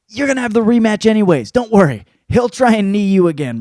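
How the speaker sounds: loud, high, insistent; emphatic reassurance. Acted. Fan telling fighter not to worry about rematch, loud insistence.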